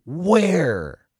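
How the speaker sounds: Where high and loud, falling slowly; overblown Where. Shown as a demonstration. Coach acting it out, melodramatic loud Where. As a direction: burst into the room with a loud Where. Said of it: "I come into the room and I go".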